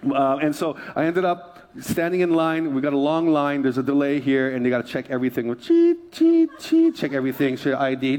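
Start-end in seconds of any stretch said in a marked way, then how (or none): 6.1-7.0 high mock beeping; high mock scanner beep. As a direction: squeak like a barcode scanner. Imitating scanner beeps.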